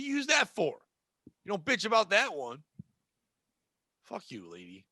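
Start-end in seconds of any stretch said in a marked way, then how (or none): 4.0-4.8 low, quiet muttered aside under the breath; muttered curse. Acted. Clerk muttering at a difficult customer, low and quiet. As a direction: grumble it so the customer can't hear.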